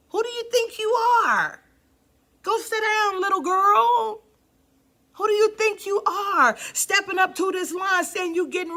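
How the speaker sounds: mock church mothers scolding; scolding older women. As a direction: sneer at the upstart girl.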